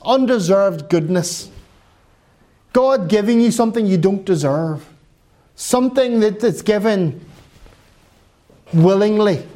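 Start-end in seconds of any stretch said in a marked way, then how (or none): none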